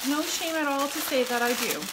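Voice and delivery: ordinary talk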